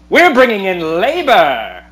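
lightly voiced thirties newsreel; newsreel announcer. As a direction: proclaim it like an old newsreel. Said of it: "nineteen thirties newsreel".

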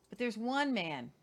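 normal talking voice

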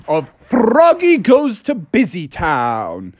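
grand, drawn-out title read; slow, singsong storybook intro; storybook announcer. Pretend. Opening a read-along story for a child.

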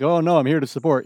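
loud, stubbornly helpful; mock eager insistence. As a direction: push back like you won't leave. His stubborn loyal reply.

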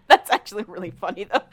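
words broken up by real laughter; laughing. Real, not acted. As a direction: laugh through the line. Laughing at the story.

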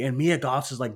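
usual speaking voice

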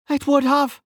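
high-pitched firm contradiction; female officer. A narrator's reading. Female officer overruling the young mage, high-voiced. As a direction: contradict him in a high character voice. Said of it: "said the female officer".

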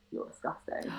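whispered, disgusted mum; hissed disgust. Retold or imitated. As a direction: breathe it out in contempt. Mum's retold cold insult.

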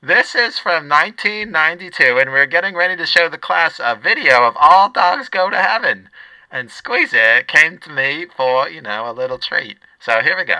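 brash lunch lady talking. Acted. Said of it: "I should just do both voices".

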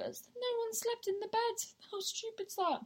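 high-pitched, incredulous exclamation. Real, not acted. Friend recounting a row where nobody slept in the bed, incredulous.

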